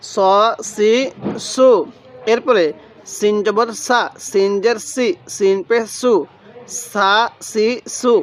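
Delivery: ordinary talk, nothing marked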